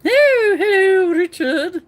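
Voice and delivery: very high, loud gushing hello; hammed-up delight at a guest arriving; effusive greeting.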